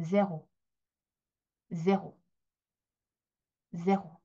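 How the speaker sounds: 제로 with a French r in the throat; 제로 as French zéro. Shown as a demonstration. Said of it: "에흐 발음 때문에 이렇게 읽는 거예요".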